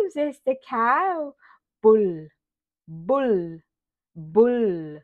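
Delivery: ordinary talk, nothing marked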